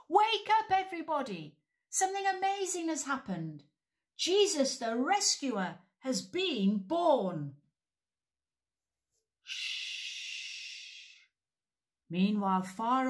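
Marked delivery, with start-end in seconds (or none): 0.1-7.6 high loud excited shout. Shown as a demonstration. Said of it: "Can we shout that?". 9.4-11.3 unvoiced shh; hush. A narrator's reading. Hush between scenes.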